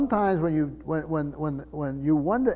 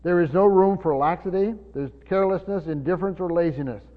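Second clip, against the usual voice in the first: louder, driving preacher cadence; preaching at full force; 5 dB louder than usual. Real, not acted. Pastor exhorting the church to run the race, full force. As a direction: rouse them to run the race.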